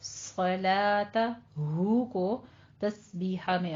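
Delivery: सलाता and तस्बीहा recited slowly and drawn out; slow recitation. Shown as a demonstration. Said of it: "सौ पुर पढ़ेंगे".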